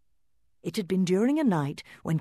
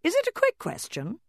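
wary mother doll. Acted. Mama doll answers.

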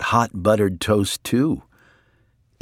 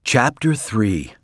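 plain reading voice

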